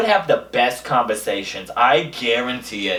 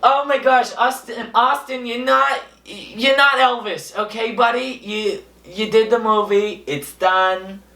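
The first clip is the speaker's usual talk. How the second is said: fed-up crowd scolding the actor, pitched up; exasperated scolding; 7 semitones higher than usual. Retold or imitated. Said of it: "And everyone was like".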